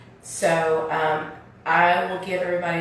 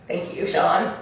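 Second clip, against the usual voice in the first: ordinary talk, nothing marked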